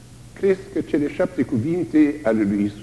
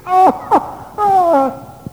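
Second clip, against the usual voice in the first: high, loud cry of pain; anguished wail; 6 dB louder, much slower than usual. Acted. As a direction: wail without words. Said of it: "nu poate să spună durerea". (geamăt de durere)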